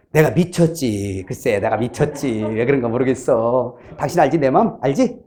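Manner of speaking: low, hushed, sheepish apology; acted contrite coaxing. Repentant spouse pleading for understanding, low contrite coaxing.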